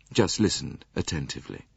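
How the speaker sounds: ordinary delivery, nothing marked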